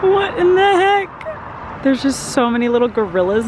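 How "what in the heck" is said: slow, high, drawn-out disbelief; baffled amazement. Real, not acted. Vlogger staring at a giant dragon statue, baffled. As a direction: let the words hang, bewildered.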